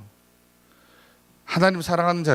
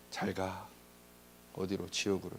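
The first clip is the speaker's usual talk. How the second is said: much softer, lower, dread-filled farewell; God dismisses the faithless, soft and low; ominous quiet; 4 semitones lower, 16 dB quieter than usual. Acted. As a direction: let it drop cold into a near-hush.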